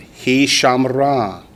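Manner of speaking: slow "He shamra", SHAM-ra with a closed first syllable; teacher drills a Hebrew form; slow Hebrew model. Shown as a demonstration. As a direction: draw out "He shamra" for the class. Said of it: "the second root letter becomes part of the first syllable".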